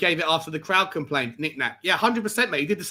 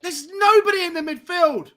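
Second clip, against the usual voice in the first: high, slow despairing shout; exasperated outcry; 10 semitones higher, 4 dB louder than usual. Real, not acted. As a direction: cry out at the empty midfield. Team overrun, he despairs.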